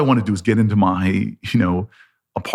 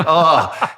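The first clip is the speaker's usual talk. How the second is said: high-pitched mock groan of reluctance; exasperated groan; 6 semitones higher, 3 dB louder than usual. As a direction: groan as if giving in grudgingly. Reluctant groan before grudging hug.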